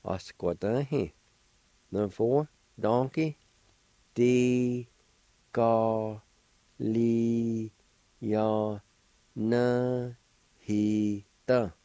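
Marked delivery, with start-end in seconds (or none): 4.2-11.7 "Decali- ya na he da" in long, separated syllables. Shown as a demonstration. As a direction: hold and separate each beat of "Decali- ya na he da". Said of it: "I'll pronounce these words".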